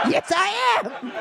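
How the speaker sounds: high, slow, hammed-up confirmation; proudly admitting it; mock proud heckler. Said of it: "Sure am".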